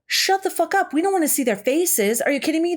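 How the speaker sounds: mock outburst at friends in a haunted house. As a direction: cut your friends off in disbelief. Imagined outburst at companions.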